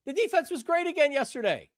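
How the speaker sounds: usual speaking voice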